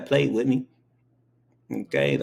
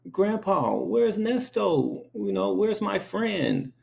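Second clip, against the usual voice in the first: put-on high, slow, pleading little kid voice; little child asking; 10 semitones higher, slower than usual. Small child asking grandpa about her cow, high and slow. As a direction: ask like a worried three-year-old. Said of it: "I'm like".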